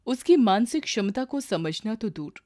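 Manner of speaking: ordinary delivery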